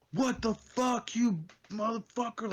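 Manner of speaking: imitated yelling. Driver yelling like his car was hit, high mock yell. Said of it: "Like, yelling, like, like I hit his car".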